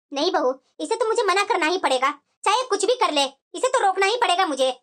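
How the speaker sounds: put-on low, firm old mother-in-law voice; low, stubborn matriarch refusing; stern mother-in-law. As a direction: lay down the law as the strict mother-in-law. Mother-in-law voiced by same actor.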